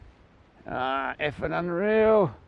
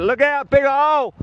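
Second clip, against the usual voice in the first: high, loud urgent shout; yelled warning; 10 semitones higher, 6 dB louder than usual. Real, not acted. Cyclist has to tell Rudy about a hole, yelling. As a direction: yell it back to the riders behind. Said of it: "Sorry about the yelling".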